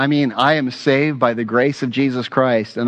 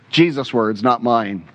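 usual speaking voice

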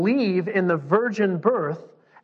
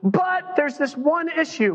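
amazed Abraham; 13 semitones higher than usual. Acted. Abraham reacting to God's promise of descendants, amazed. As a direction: react with hammed-up awe, pitched way up. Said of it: "Abraham's like".

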